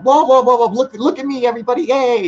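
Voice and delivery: showing off how wild he hosts, calling for attention; hammed-up giddy show host; hyper host. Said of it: "I'm all over the place".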